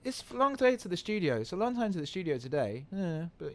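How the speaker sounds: put-on high little-kid voice; imitated young son. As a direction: mimic a small boy, pitched well up. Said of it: "He's like".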